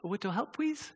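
put-on soft baby-talk with W for L and R; small child. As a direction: ask sweetly in toddler speech, "please" as "pweese". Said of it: "a kid at that height can't say that".

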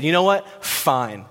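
exasperated and pushing, quick bursts; frustrated, done. Acted. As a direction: act out washing your hands of them. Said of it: "And he is frustrated".

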